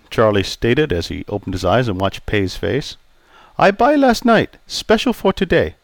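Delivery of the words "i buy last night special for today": put-on raised, accented old barber voice; polite Chinese-accented barber greeting. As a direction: voice Pei the barber, courteous.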